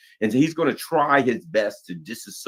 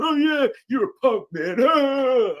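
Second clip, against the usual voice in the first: put-on drunk bully; 6 dB louder, slower than usual. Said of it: "in a bar".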